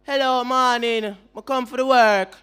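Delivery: a hammed-up Jamaican patois accent; mock patois job interview. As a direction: play the candidate speaking patois at the interview. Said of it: "and we go on the interview and be like".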